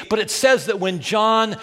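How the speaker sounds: normal talking voice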